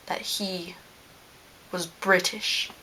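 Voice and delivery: secretive whisper on he. Real, not acted. Vlogger confiding science was British, whispered. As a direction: lean in and whisper.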